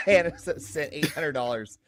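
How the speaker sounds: laughing through the words. Real, not acted. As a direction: giggle while reading it out. Laughing over fake superchat.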